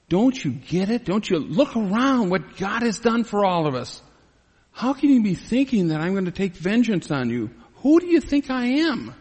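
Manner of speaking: high, impassioned appeal; hurt disbelief, rising to a high protest; incredulous pleading. Acted. Acts Joseph's indignant reply to brothers.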